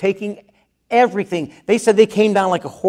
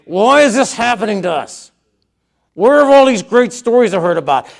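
louder and higher, raw frustration; angry; 4 semitones higher, 4 dB louder than usual. Retold or imitated. Gideon shouting his anger at God, loud and raised. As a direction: fists clenched, demand an answer. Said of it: "I think he was angry".

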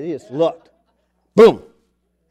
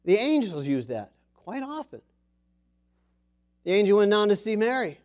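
ordinary delivery

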